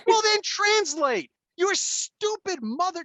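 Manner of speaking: slow, high, loud enraged demand; enraged father yelling at the daughter; retold yell. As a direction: shout it slowly word by word. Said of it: "I'm like".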